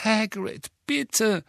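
high, desperate pleading; desperate plea. Acted. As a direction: beg with real despair. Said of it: "echte Verzweiflung lag in ihrer Stimme".

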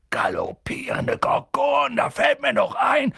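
a giant's sudden outburst; Hagrid exclaiming. A narrator's reading. Hagrid slaps his forehead, remembering, and bursts out.

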